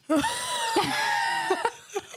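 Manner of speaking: high shrieking laugh. Real, not acted. Bursts out after a mock threat.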